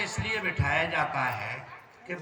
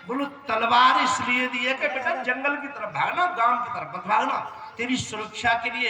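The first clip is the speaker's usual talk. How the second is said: raised, louder, building to the laugh; rising comic fervor; 6 semitones higher, 4 dB louder than usual. Acted. Preacher joking to wedding crowd, raised and laughing. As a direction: preach it higher and louder with a grin.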